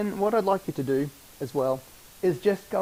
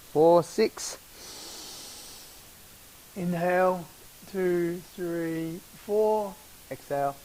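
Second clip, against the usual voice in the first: counting cues; 3 dB louder, much slower than usual. Acted.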